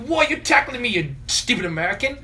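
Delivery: high-pitched hammed-up complaint; mock aggrieved kid. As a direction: imitate an outraged foreign kid. Second speaker mimics the complaining kid.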